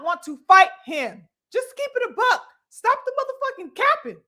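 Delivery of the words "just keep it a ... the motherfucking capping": exasperated. Real, not acted. Exasperated callout of fighter.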